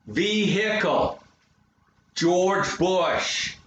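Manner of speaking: exaggerated American stress, drawn out; hammed-up American, leaning hard on each word; slow, emphatic American drawl; American stressed speech. Shown as a demonstration. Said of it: "Americans tend to stress things".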